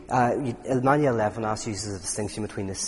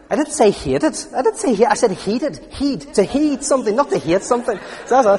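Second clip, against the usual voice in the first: much louder and higher, insisting on heeded not hated; mock outrage, hammering the word "Heed" as a long clear hid; "heeded" said with a long ee, set hard against "hated"; exasperated correction; 12 semitones higher, 8 dB louder than usual. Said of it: "I go like".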